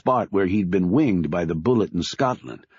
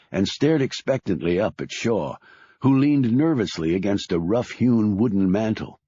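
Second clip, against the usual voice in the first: ordinary delivery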